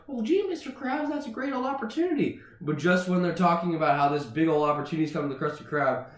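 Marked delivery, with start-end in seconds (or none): 0.1-2.4 high-pitched eager cartoon voice; SpongeBob. Eager employee answers his boss, high cartoon voice. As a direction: chirp like a keen cartoon employee. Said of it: "And SpongeBob goes".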